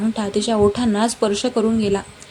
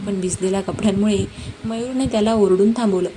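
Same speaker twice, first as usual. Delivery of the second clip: ordinary delivery, nothing marked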